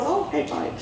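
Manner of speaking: soft, grudging give-in; mock grudging. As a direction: sigh it out reluctantly. Mock reluctant reply.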